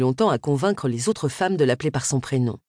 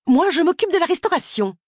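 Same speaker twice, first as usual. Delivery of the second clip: much higher, defensive protest; indignant Miss Sims; 13 semitones higher, 4 dB louder than usual. Acted. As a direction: snap back in her own defence.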